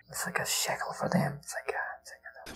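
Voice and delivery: breathy whisper, almost no voice; hushed scheming whisper; whispered aside. Retold or imitated. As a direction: whisper it like a secret plan. Gavin muttering a scheme.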